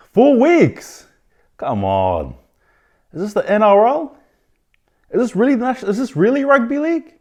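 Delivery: outraged outcry. Real, not acted. Host railing at the league over four weeks, loud outraged cry. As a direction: plead with the whole league, exasperated.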